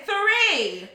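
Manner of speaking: high, loud one-word retort; exasperated shout. Retold or imitated. Customer arguing with a waitress over table size, exasperated shout. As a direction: fire the answer back loud and high. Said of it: "kind of screaming at each other".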